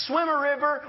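usual speaking voice

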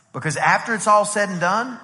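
ordinary talk

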